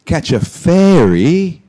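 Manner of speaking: slow, loud, swooping high question; mock disbelief. Teacher querying the student's fairy slip, mock disbelief. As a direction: stretch FERRY as FAIRY, high and incredulous.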